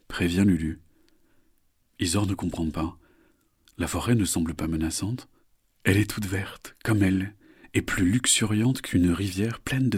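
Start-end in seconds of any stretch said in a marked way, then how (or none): none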